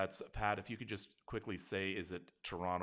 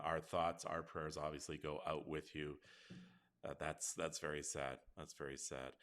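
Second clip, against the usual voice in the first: slow, soft voice holding back tears; real emotion catching in the throat; choked up; 6 semitones lower, 4 dB quieter, slower than usual. Real, not acted. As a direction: fight back tears gently. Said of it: "sorry, I'm getting a little choked up".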